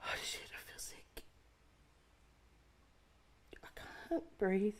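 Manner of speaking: breathless panicked whisper; panicking. Acted. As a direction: barely get the words out, panicking. Panic attack alone.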